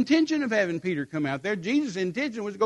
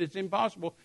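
usual speaking voice

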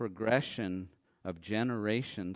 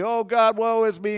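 higher, louder melodramatic wail; mock wail; 9 semitones higher, 11 dB louder than usual. Preacher mimics Elijah's self-pitying wail. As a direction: ham up a self-pitying lament.

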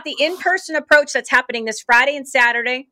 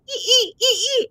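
squeaky cricket noise, much higher; high mock cricket chirp; 11 semitones higher than usual. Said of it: "all I hear this all day".

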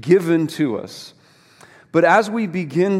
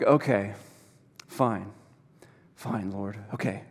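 lower, quieter resigned concession; grudging surrender; 6 semitones lower, 7 dB quieter than usual. Retold or imitated. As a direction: give in reluctantly, letting each word sink. Grudgingly gives in to God.

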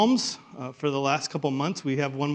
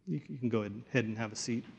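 ordinary talk, nothing marked

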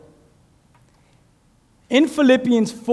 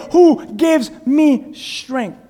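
slow, drawn out; 5 semitones higher, 4 dB louder, much slower than usual. Shown as a demonstration. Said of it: "Another version might say".

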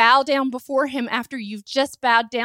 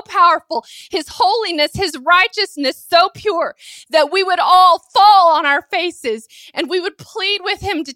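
raised, urgent pulpit voice; impassioned preaching; 3 semitones higher, 5 dB louder than usual. Real, not acted. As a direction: preach at full force, overwhelmed by God's holiness. Preaches at full force.

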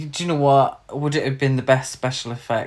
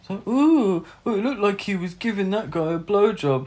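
high, mock-delighted gushing; 6 semitones higher than usual. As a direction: gush mockingly over a silly joke. Mocking the film's juvenile humour.

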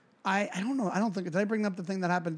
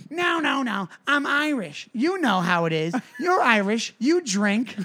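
high, insistent tipsy pleading; put-on high voice, easing down; mimicked drunk woman; 5 semitones higher, slower than usual. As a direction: imitate a drunk woman making her case. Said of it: "at one point she goes".

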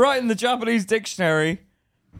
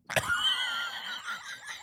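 high burst of laughter; helpless laughter; 9 dB quieter than usual. Real, not acted. Listener cracking up at the neighbour's name, helpless laughter. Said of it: "Why are you laughing".

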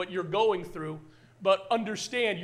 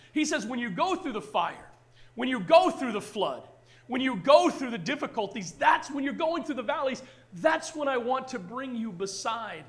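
building preacher refrain; 4 semitones higher than usual. Acted. Pastor lifting the congregation, building preacher cadence.

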